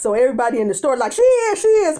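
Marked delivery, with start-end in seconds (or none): 1.1-2.0 high, loud eager call; eager crowd. Retold or imitated. Whole store calling out at once, high and loud. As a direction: call out, pointing someone out.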